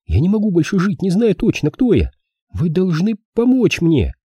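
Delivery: put-on high-pitched desperate girl; pleading. A narrator's reading. Girl begs the detective to help, high pleading. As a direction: beg him, slowing at the end. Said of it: "Она умоляюще наклонилась к детективу".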